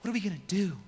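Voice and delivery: mock-worried grumbler; lightly played helpless question. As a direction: act out a fretful complaint. Acts out the people panicking.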